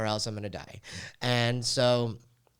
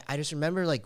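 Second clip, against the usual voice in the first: ordinary talk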